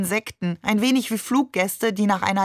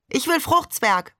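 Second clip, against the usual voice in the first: put-on little boy whining; 8 semitones higher than usual. Little brother demanding sweets.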